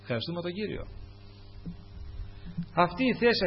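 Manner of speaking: usual speaking voice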